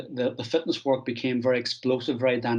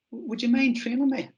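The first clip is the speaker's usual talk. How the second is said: high-pitched imitation of a Dubliner; Bernard Dunn; 11 semitones higher than usual. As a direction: ask it in a put-on, high Dublin voice. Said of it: "That's my Dublin accent".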